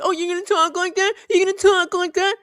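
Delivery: high mocking stranger's voice; imitated challenger. Offended stranger challenging the dog walker, high mock voice.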